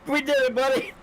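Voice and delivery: very high, overjoyed celebration; high-pitched, thrilled cheer; elated disbelief. Real, not acted. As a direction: squeal it to your buddy in amazement. Celebrating the result with teammate.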